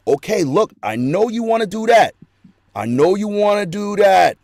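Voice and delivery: a knowing voice reining someone in; God calming you. Retold or imitated. God talking an eager believer down, loud and patient. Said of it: "God is gonna be like".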